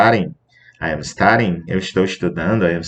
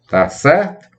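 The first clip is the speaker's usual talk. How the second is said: ordinary delivery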